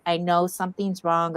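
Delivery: ordinary talk, nothing marked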